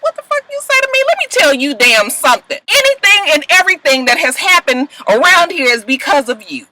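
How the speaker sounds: high, sharp mock yell at him; furious comeback. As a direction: snap back at him in outrage. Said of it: "she's like".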